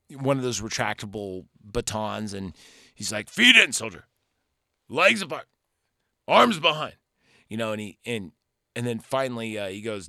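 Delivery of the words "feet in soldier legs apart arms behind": loud drill sergeant commands, pitched up; imitating a barking boot-camp instructor; Lee Army Ermey. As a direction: shout orders at a line of recruits. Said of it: "very much like Lee Army Ermey".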